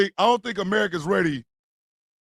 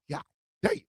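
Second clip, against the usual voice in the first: usual speaking voice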